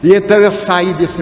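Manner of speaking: ordinary delivery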